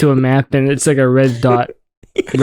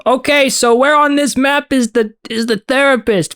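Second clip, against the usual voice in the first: raised voice over a roaring generator; shouting over machinery; 12 semitones higher, slower than usual. Pretend. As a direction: yell the question over engine noise. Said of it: "I'm shouting over the generator now".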